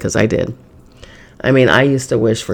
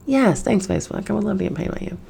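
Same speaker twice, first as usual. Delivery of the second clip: higher and softer, fake-polite; mock sweet reply; 7 semitones higher, 6 dB quieter, faster than usual. Answering Facebook's offer, mock sweet and soft. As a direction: coo it back with fake gratitude.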